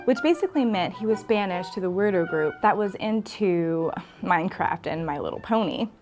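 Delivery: ordinary delivery, nothing marked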